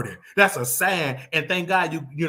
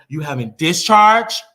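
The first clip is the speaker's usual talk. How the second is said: mock nurse blurting "discharge" loud and slow; 3 semitones higher, 8 dB louder, slower than usual. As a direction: blare the word "discharge" so everyone hears. Nurse repeats loudly.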